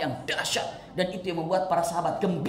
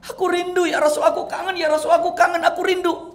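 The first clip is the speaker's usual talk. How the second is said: high, louder, tearful pleading; sobbing longing; 13 semitones higher, 7 dB louder than usual. Acted. Thauban clinging to the Prophet, high tearful pleading. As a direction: pour out how much you missed him.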